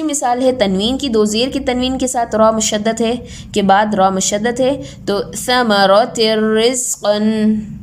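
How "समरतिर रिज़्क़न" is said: समरतिर रिज़्क़न slow with र doubled and no pause; merged, no nasal. Shown as a demonstration. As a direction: recite समरतिर रिज़्क़न slowly without गुन्ना. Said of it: "हमने मिलाया है लेकिन गुन्ना के लिए रुके नहीं".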